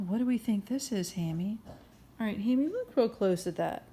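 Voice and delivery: ordinary delivery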